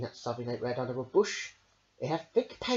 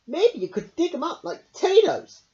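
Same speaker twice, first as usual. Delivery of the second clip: hopefully; 13 semitones higher, 9 dB louder than usual. Acted. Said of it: "said Hedgehog hopefully".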